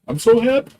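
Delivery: squeaky high mock sob of joy; mock happy squeal. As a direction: squeal it like you're overjoyed to tears. Imagining crying with joy on stream.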